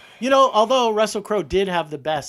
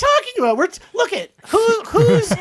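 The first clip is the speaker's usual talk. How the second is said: high-pitched exasperated shout; indignant outburst; 14 semitones higher, 4 dB louder than usual. Real, not acted. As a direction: blurt it out, high and loud. Outburst at a co-host who wants to end.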